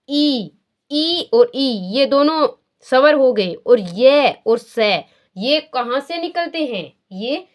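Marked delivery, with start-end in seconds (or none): 0.1-1.7 ई, ई और ई stretched very slowly; drawn-out vowels. Shown as a demonstration. Teacher stretching the vowels for the class. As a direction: hold each ई long. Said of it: "चैवर्ग होगा".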